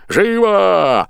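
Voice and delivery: loud high bellow of an order; yelling boatswain. A narrator's reading. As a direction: roar it across the deck. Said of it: "орал он". (боцман орёт во всё горло)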